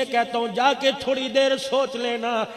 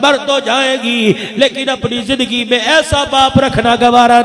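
rising to a louder, impassioned plea; tearful daughters' plea; 8 dB louder than usual. Acted. Daughters plead with their father, building louder.

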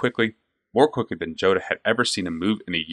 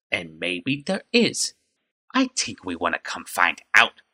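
slow, sly goblin threat; a goblin toying with its prey; menacing goblin voice; slower than usual. A narrator's reading.

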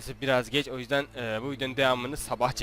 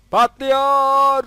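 high, loud cry of delight; excited yell; 11 semitones higher, 10 dB louder than usual. Real, not acted. Gamer yells as the race car explodes, loud and high. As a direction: shout it like the fireworks just went off.

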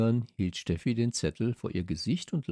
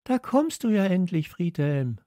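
high-pitched call; 9 semitones higher, 4 dB louder than usual. A narrator's reading. Girl greets her late friend, calling out. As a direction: call out in a much higher voice. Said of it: "rief Steffi".

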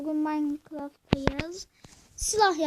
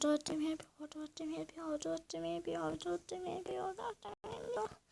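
fading repetition; 12 dB quieter than usual. Real, not acted. Repeating item count, fading.

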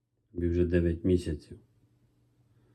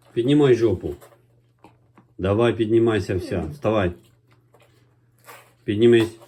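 loud, higher urging at a puppy; firm dog commands, louder; talking to a dog; 4 semitones higher, 5 dB louder than usual. Real, not acted. Coaxing the dog to stand.

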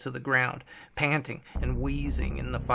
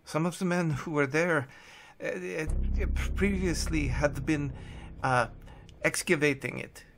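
put-on shaken, hesitant German-accented account; frightened German captive; 3 semitones higher than usual. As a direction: confess haltingly with a German accent. Plays Hess, frightened German captive.

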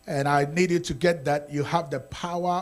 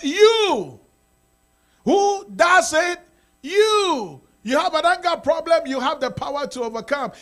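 loud, high preaching, each you driven home; full-force preaching; 10 semitones higher, 8 dB louder than usual. Acted. Pastor shouting to the congregation that they must act. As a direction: hammer the point home from the pulpit.

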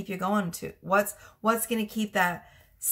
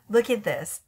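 ordinary delivery

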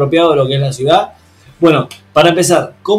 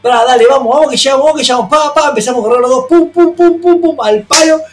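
high, loud, frantic race commentary; arenga frenética actuada; 10 semitones higher, 5 dB louder than usual. Retold or imitated. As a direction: urge someone on as if sprinting for a train. Acted urging and sprint to train. (arenga aguda y a los gritos, actuada)

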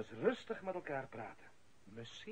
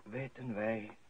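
solemn eulogy; 4 semitones lower, 5 dB quieter than usual. Acted. Priest at the funeral, a solemn eulogy. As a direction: speak as if over a grave, softly.

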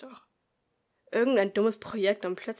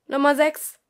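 loud, higher announcement; calling out; 6 semitones higher, 13 dB louder than usual. Acted. Teacher announces the number to the class, loud. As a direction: call it across the classroom.

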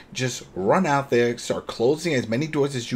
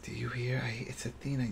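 hushed quote; 11 dB quieter than usual. Retold or imitated. Couple hears baby crying.

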